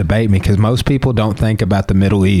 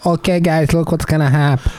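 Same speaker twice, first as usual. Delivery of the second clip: put-on Brazilian accent; 8 semitones higher than usual. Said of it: "talking like a Brazilian".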